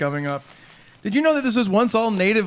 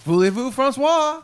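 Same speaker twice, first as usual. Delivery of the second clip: slow, clumsy French; foreign accent in French; 7 semitones higher than usual. Acted. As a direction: mangle the French slowly. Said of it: "Chuck attempts to speak French, but isn't perfect".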